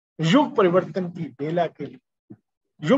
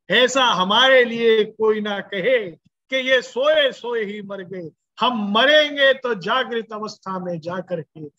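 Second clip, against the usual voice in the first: raised, forceful pulpit oratory; impassioned preaching; 4 semitones higher than usual. Real, not acted. Preacher urging a life of action until death, impassioned. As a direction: declare it as a stirring vow. Vowing to die awake and working.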